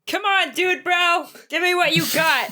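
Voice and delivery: high, brash taunting of a rival; teasing battle taunt; cocky taunt. Acted. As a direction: egg him on, full of swagger. Taunting opponent in sword fight.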